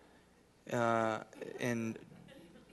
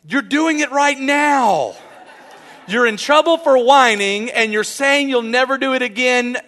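loud, high-pitched scolding; exasperated dad; 10 semitones higher, 13 dB louder than usual. Retold or imitated. Dad to whining daughter in trouble, loud exasperation. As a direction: raise your voice, hammed-up fed-up parent.

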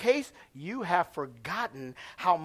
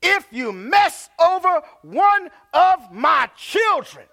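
slow, higher shouted warning; serious Jesus warning; 9 semitones higher, 8 dB louder, slower than usual. Acted. Pastor voicing Jesus to parents, slow shouted threat. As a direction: thunder it out slowly as Jesus. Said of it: "Jesus is getting serious right here".

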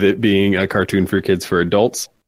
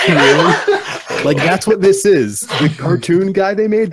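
higher, laughing amazement; excited disbelief; 6 semitones higher than usual. Real, not acted. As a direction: marvel at it, laughing.

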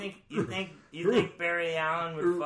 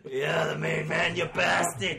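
shouted, louder and slowed, rowdy biker brag; Lobo shout; 6 dB louder, slower than usual. Retold or imitated. Host shouting Lobo's catchphrase at his friends, rowdy. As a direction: bellow it like a drunk biker. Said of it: "He's all like".

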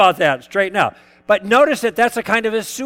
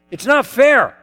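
higher, louder frustrated protest; 6 semitones higher, 5 dB louder than usual. Retold or imitated. Speaker imitating a student who cannot win the argument, frustrated outburst. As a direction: blurt it out, cornered and annoyed. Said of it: "he gets really frustrated".

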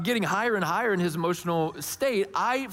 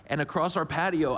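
ordinary talk, nothing marked

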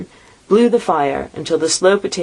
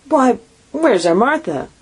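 higher, slow cheerful call; cheery father; 8 semitones higher than usual. A narrator's reading. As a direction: look round for his daughter. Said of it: "cried Bob Cratchit".

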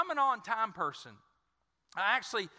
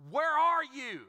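raised, pleading call upward; anguished cry to God; 7 semitones higher, 5 dB louder, slower than usual. Retold or imitated. Pastor retelling his prayer, crying out to God. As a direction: cry it out like a lost man praying.

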